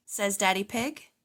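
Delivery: usual speaking voice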